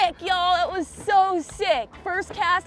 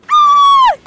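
very high, loud scream; piercing victory shriek; excited scream; 13 dB louder than usual. Real, not acted. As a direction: scream out in sheer joy. Celebrating friend's giant catch.

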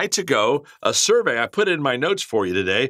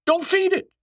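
high emphatic plea. Real, not acted. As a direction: cry out "Don't feed it" very high. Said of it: "listen to me".

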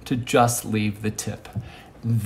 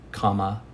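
"comma" said low and soft, like a pause mark; spoken punctuation; 5 semitones lower, 5 dB quieter than usual. Shown as a demonstration. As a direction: drop in quietly with "comma". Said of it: "Commas are".

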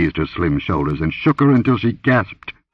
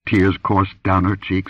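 ordinary delivery, nothing marked

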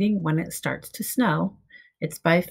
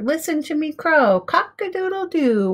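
higher, crowing rooster call; proud rooster; 9 semitones higher than usual. A narrator's reading. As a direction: crow it out proudly. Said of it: "says the rooster".

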